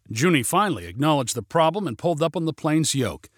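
ordinary delivery, nothing marked

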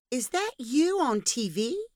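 put-on small boy asking, wide-eyed; child voice. A narrator's reading.

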